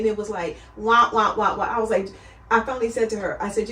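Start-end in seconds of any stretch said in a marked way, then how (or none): 0.8-1.6 mock droning noise. Imitating a droning doctor.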